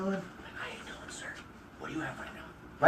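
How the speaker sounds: mirroring a low-talking customer in a slow whisper. Retold or imitated. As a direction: whisper it back to match a shy guy. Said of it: "If he's very low, you're gonna talk low with him".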